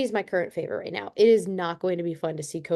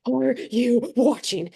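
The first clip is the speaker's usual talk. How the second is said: slow, higher, drawn-out mock panic; retold hyperventilating excitement; 5 semitones higher, much slower than usual. Caller asking if he's watching, hyperventilating excitement. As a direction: act out breathless excitement, stretching each word. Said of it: "I am literally hyperventilating".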